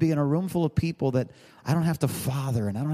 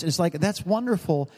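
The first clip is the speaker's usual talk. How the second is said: ordinary talk, nothing marked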